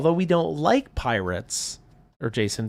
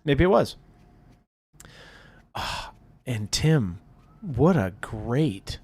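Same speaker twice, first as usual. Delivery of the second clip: ordinary talk, nothing marked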